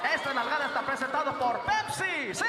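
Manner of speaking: loud booming commercial announcer; radio sponsor tag. Retold or imitated. Radio host plugs a sponsor, booming ad voice. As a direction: read it like a sponsor plug. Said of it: "talking like that".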